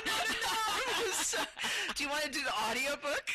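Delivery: high giggling protest; laughing. Real, not acted. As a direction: laugh through the words.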